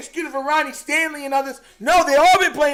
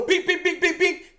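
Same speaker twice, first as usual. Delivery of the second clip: higher, rapid string of little beeps; imitated beeps; 5 semitones higher, 4 dB quieter than usual. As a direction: beep like a tiny speeding cartoon car. Mimics Lamar running for touchdown.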